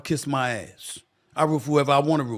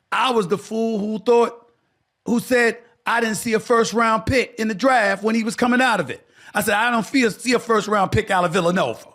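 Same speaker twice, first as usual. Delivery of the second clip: raised excited voice; 7 semitones higher, 4 dB louder than usual. Real, not acted. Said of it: "I got to eat crow".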